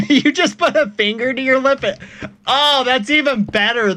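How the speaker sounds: high laughing delivery; giggling while reading chat. Real, not acted. Streamer laughing while reading a chat message.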